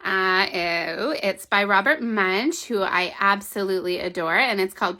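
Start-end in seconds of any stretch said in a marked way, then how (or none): none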